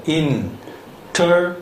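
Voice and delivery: "international." drawn out syllable by syllable, very slowly; very slow model word. Shown as a demonstration. Teacher slowly models "international." syllable by syllable for the class. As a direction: stretch every syllable of "international." out for dictation. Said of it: "음절 단위로".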